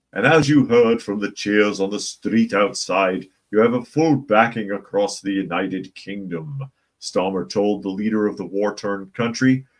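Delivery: lightly put-on British accent reading Starmer's quote; a hint of posh British statesman, mocking. Reading Starmer's words to Zelensky in a lightly mocking British accent. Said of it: "I know I switched up the accent".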